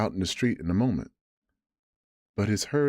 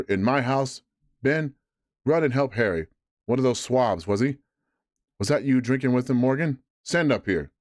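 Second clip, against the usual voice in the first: higher, louder blustering landlord; angry sea cook; 5 semitones higher, 4 dB louder than usual. Acted. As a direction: roar at the tavern crew. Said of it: "cried Silver".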